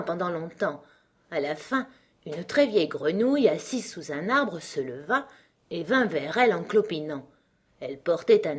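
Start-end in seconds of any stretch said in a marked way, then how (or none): none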